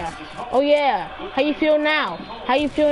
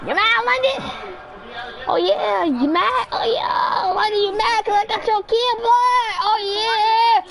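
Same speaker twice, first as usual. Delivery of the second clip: yelling taunt; 8 semitones higher, much slower than usual. Real, not acted. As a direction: crow it at him, pitched way up.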